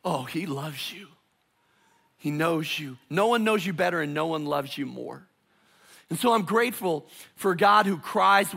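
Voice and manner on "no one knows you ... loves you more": normal talking voice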